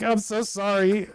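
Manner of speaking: slow, fake-sorry apology; a drawn-out insincere sorry; mock-humble sorry to the donor. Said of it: "You're like".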